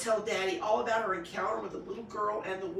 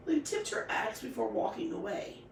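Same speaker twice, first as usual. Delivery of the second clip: ordinary delivery, nothing marked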